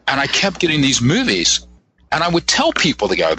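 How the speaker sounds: ordinary delivery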